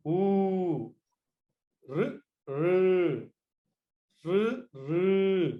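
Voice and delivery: "A", "I", "U", "R" each held long as Dirgha Swaras; long vowels. Shown as a demonstration. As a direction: stretch every vowel out long and steady. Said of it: "if you elongate it little more, it's called Dirgha Swaras".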